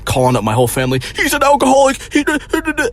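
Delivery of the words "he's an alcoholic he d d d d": mocking panicked mom. Mom calling up the family, high and frantic.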